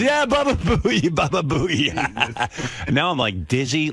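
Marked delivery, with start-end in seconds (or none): 0.0-1.9 put-on high, slow, gleeful "Baba Booey" in a thick Italian accent; hammed-up Italian salesman relishing "Baba Booey". Salesman repeating the nickname back, slow thick Italian accent. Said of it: "he had some foreign accent".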